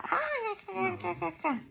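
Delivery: high cackling laugh; hammed-up high laugh; lizard laughing. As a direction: let the lizard burst out laughing. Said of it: "no pudo aguantar una carcajada".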